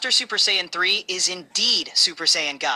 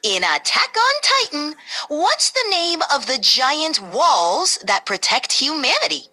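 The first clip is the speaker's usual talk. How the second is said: high squeaky voice; squeaky high-pitched voice; 7 semitones higher than usual. Acted. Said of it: "Switching to a squeaky, high-pitched voice".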